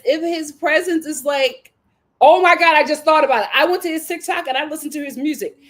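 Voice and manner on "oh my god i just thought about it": loud, high burst of discovery; sudden excited exclaim; excited realization. Real, not acted. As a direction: blurt it out as it hits. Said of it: "excited realization".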